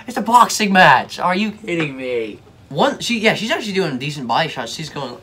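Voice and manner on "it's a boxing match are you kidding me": higher and louder exasperated outburst. Real, not acted. Reacting to a boxer complaining she got hit, exasperated shout. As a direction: rant like it's obvious.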